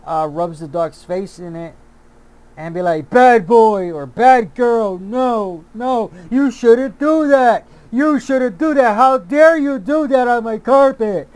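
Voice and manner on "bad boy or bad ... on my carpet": loud, higher scolding of a dog; scolding dog owner. Retold or imitated. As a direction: scold the dog for ruining the carpet. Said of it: "that's what you're sounding like".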